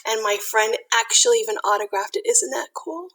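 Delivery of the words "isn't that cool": ordinary delivery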